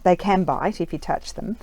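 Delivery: ordinary talk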